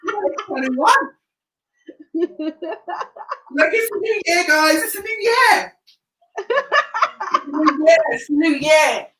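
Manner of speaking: high, giddy hype with laughter; excited. Real, not acted. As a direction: cheer it like a party host. Hyping up the new year.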